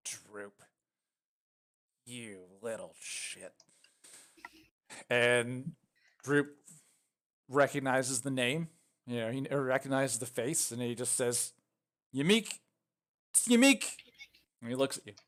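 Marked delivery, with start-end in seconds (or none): 0.1-3.5 low, slow, very quiet growl of recognition; menacing goblin. Acted. Caged goblin recognizing Droop, quiet slow menace. 12.1-13.8 high, loud, rising goblin exclamation; startled recognition. Acted. Droop recognizing the caged goblin, startled.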